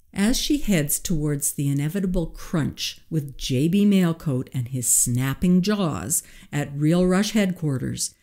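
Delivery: ordinary delivery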